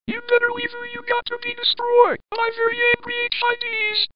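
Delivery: high-pitched, rapid warning; fast, very high voice chasing off an intruder; angry threat. Acted. Angry HID lamp to the leaving LED, high quick threat.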